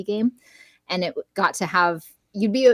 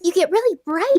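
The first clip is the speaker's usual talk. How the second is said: bright princess demo voice; 12 semitones higher, 6 dB louder than usual. Shown as a demonstration. Voice actor explaining the booth to hosts, bright princess voice. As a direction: get really bright, like an animated princess. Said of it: "doing a princess voice".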